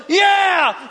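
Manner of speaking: high mock-Scottish war cheer; Scottish troops' cheer. Troops answering their leader, rousing Scottish cheer. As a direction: roar back like Scottish warriors. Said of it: "and they're like".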